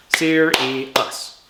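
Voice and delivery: Serious split into its three syllables, one beat each; syllables clapped out. Shown as a demonstration. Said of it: "I'm gonna clap out the syllables for serious".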